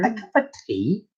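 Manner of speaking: put-on a deliberately terrible British accent; mock British accent, played for laughs; bad British accent. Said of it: "Worst British accent ever".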